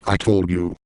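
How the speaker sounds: usual speaking voice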